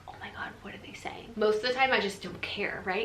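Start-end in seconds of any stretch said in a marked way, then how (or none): 0.1-1.3 breathless gossipy whisper; nosy whisper. Retold or imitated. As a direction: lean in like a snooping neighbor. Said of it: "the nosy neighbor that's like".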